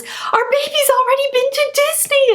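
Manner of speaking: high, delighted quote of her husband; quoting her husband's thrilled reaction, high and gleeful; excited husband. Retold or imitated. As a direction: gush it like an overjoyed new dad. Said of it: "his reaction to that was".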